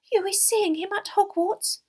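high, squeaky questioning elf; high-pitched astonished elf; amazed house-elf. Acted.

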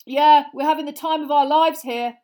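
loud, high, squashed voice; muffled, sarcastic. Acted. George squashed against the wall, muffled sarcasm. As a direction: sound jammed against a wall. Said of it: "whose voice sounded muffled".

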